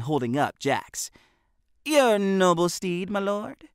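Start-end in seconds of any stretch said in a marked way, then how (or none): 1.8-3.7 mock butler. A narrator's reading. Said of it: "Benny feigned shock".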